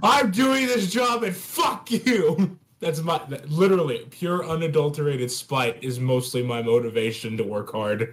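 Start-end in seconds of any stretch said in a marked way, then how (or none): 0.0-2.6 high, slow, stretched-out defiance; mock spiteful rant. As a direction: play up the spite, drawing words out. Acts out spiteful motivation.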